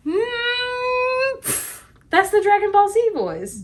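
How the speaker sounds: high, drawn-out anime announcer voice; anime hype voice. Pretend. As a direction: ham it up like a hyped anime fan. Dragon Ball Z meme, hyped.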